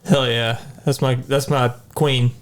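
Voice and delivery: normal talking voice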